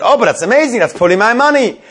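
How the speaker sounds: high, excited exclamation. Retold or imitated. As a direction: voice the woman's sudden thrilled hope. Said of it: "she's all excited".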